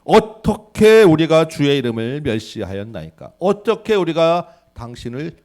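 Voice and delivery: loud, slow, offended retort; indignant protest. Retold or imitated. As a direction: argue back as if wrongly accused. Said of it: "백성들의 반응이".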